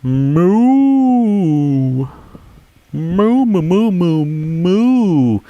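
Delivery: high mooing like a cow. Pretend. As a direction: moo out like a silly cow. Answering the cow question.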